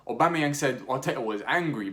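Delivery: normal talking voice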